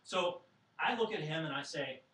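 normal talking voice

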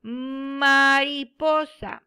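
put-on slow, clearly spaced syllables for children; teacher stretching the word out for pupils; slow model word. Shown as a demonstration. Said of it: "¿Cómo se llama?".

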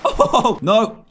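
panicked yelling. Real, not acted.

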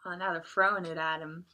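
ordinary delivery, nothing marked

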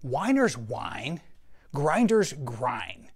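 slow, weighty saying; deliberate catchphrase delivery; slow motto. Shown as a demonstration. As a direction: lay the motto down slowly, word by word. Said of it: "I have a saying".

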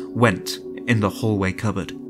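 plain reading voice

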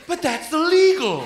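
put-on high, loud, drawn-out kid protest; slow mock-horrified kid voice; shocked great-grandchild. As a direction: cry out like a scandalized child. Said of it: "running up to their great-grandfather like".

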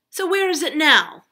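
loud, high, insistent question; demanding townsfolk. A narrator's reading. Townsfolk questioning Arabella, demanding. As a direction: call it out, wanting answers.

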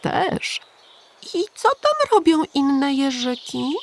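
put-on high childlike wondering question; a small boy amazed; curious little hedgehog. A narrator's reading. Little Igiełka asking the pigeon, high child voice.

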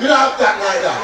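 loud, high, curt refusal with attitude; rude Jamaican store lady. Retold or imitated. Jamaican shop lady loudly snapping at a customer, no. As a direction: shut the customer down with a loud snap. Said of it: "they face me already like this".

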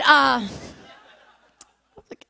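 normal talking voice

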